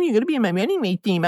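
hammed-up coach telling him flatly; mocking pushy coach.

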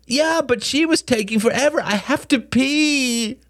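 put-on very high child's wail, slowing at the end; squeaky, stretched-out whine; whining little boy. Small boy wails his excuse.

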